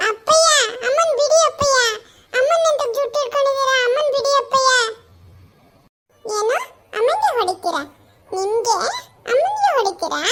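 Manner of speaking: put-on high, loud kid's cry; a little boy begging dad to let mom go; child pleading. Child begging father to release mother's hair, high and loud.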